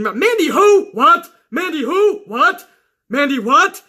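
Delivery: high, loud, incredulous repeats of who and what; exaggerated mock bafflement; mock confused. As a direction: play dumb, as if the name means nothing. Mocking Sasha not knowing Mandy.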